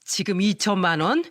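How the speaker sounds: ordinary delivery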